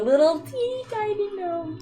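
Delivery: high, soft, slowed-down little voice; teeny-tiny voice. Pretend. Player teasing about a clumsy gnome, teeny-tiny voice. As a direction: make it small and dainty, drawn out.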